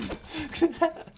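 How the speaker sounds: high squeaky laugh; sudden high-pitched laugh; high burst of laughter. Real, not acted. Laughs at own bit.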